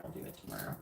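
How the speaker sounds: lazy whispered promise. Retold or imitated. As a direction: breathe it out half asleep. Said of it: "kinda resting on".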